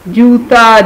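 ordinary delivery

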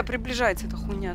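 normal talking voice

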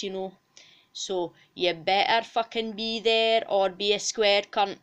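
forceful, sweary Scots sales pitch; emphatic broad Scots character voice; bossy Scottish cow. Acted. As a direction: order the fans about as a feisty Scottish mascot. Bonnie's sweary closing plug.

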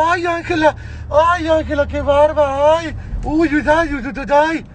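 high, squealing mock cries of delight; mock swooning cries. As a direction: ham up the fans gushing over her. Mocking the crowd's swooning cries. (gritos burlones y agudos de emoción)

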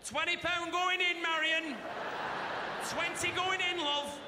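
high, slow call across the shop. Retold or imitated. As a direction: sing out the order to a coworker. Cashier calling out in Greggs.

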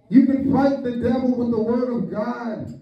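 usual speaking voice

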